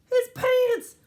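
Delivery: high, loud exclamation; playful high-pitched outburst; mock shock. A narrator's reading. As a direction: exclaim it as a scandalous discovery. Reader exclaims at picture.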